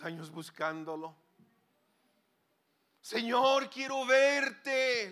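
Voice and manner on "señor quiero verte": loud, drawn-out cry of longing, very slow; pleading cry to God. Acted. Preacher voicing a believer's prayer, loud slow pleading. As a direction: cry out to the Lord, stretching every word.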